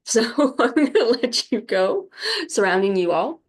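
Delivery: real teary laugh while signing off; laughing through tears. Real, not acted.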